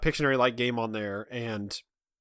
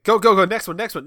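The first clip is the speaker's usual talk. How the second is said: impatient hurry; 8 semitones higher, 7 dB louder than usual. Retold or imitated. Impatient players hurrying drawer.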